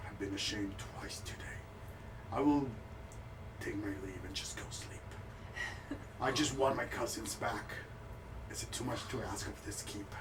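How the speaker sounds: low, soft, stiff formal speech of a shamed noble; humiliated noble. Acted. Shamed noble telling the cleric his terms, low and subdued. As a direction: hold your dignity while deeply embarrassed.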